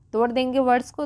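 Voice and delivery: normal talking voice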